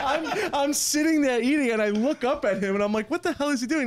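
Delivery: high-pitched, laughing disbelief; real laughter lifting the voice high; cracking up. Real, not acted. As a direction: tell it through helpless laughter. Cracking up while retelling prank.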